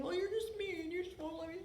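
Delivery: put-on a touch of a sulky child; mock whiny reply. Pastor imitating someone sulking over a boundary, whiny. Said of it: "they have a choice of saying".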